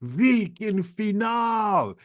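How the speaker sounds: high, slow, shouted "Oi, oi, oi, vilken final"; excited Swedish headline. Retold or imitated. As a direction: belt out the Swedish cheer high and stretched. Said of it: "So they're going".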